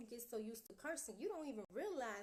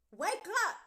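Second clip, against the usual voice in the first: loud wake-up call; 8 semitones higher, 13 dB louder than usual. Real, not acted. Preacher tells viewers to wake up, loud shout.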